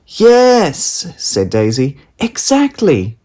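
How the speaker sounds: put-on high, slow emphatic little girl; triumphant child. A narrator's reading. Daisy to her parents, high and emphatic. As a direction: spell it out like a sure child.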